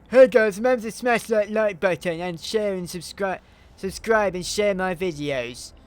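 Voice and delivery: high, loud, hammed-up YouTuber plea, slowing at the end; mock YouTuber voice. Mocking YouTubers who beg for subscribes, high hammed-up voice. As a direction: parody a begging YouTuber. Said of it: "I do really hate it when YouTubers say".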